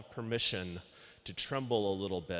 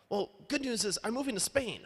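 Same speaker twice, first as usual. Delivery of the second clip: high, eager friend with big news; bubbly announcing tone, a retold friend; voicing his friend; 12 semitones higher than usual. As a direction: pitch it way up like an excited buddy. Ryan quoted announcing move.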